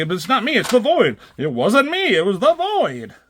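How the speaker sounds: high, fast, hammed-up pleading; mock panicked protest. Hero blaming the Void, mock panicked high protest. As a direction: squeak out the excuse in mock panic.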